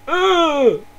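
loud, high-pitched exasperated "ugh"; mock frustrated groan. Gamer fed up that no one uses snipers, mock anguished groan.

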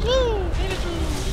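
very high, loud cheer; excited high-pitched celebration; triumphant whoop. Real, not acted. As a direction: whoop with relief at escaping. Celebrates escaping in the game.

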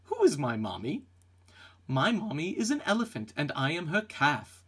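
ordinary talk

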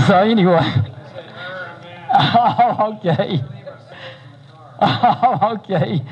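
laughing through the words; real laughter. Real, not acted. Pastor laughs at a remark from the congregation, real laughter. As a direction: break up laughing at a heckle. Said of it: "I thought I heard Randy say something".

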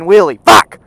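sudden loud curse; one explosive, shouted word; frustrated shout. Real, not acted. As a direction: burst it out in frustration. Truck won't do a wheelie.

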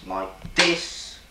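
normal talking voice

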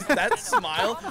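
high, slow, laughing gush; real laughter at a silly scene; laughing delight. Real, not acted. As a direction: laugh it out, charmed. Gushing about the diner scene.

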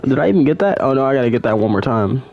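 usual speaking voice

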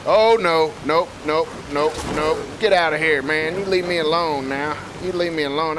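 loud, high, panicked protest; playful panic. Pretend. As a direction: yelp repeated refusals while fleeing. Flees from a bear in game.

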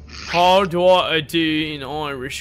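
slow, drawn-out attempt at Irish, still British; British accent. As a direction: stretch out a badly faked Irish accent. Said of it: "No, that's still British".

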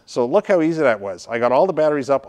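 ordinary talk

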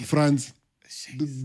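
normal talking voice

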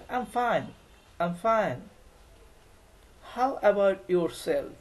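"I am fine" run smooth, "How about yourself?" slow; native-style pronunciation. Shown as a demonstration. Teacher modelling native pronunciation of the reply. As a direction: sound it the way native speakers do. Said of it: "উচ্চারণটা ওরা এভাবেই করে".

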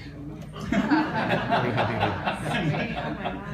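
long, soft delighted laugh; laughing in disbelief; real laugh. Real, not acted. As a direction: laugh at the memory. Laughing at the pay question.

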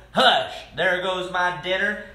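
ordinary delivery, nothing marked

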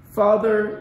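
usual speaking voice

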